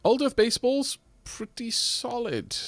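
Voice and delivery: usual speaking voice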